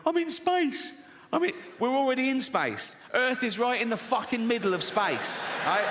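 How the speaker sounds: high, excited wide-eyed space tourist; gushing with awe, lampooned; mock space tourist. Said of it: "you float around going".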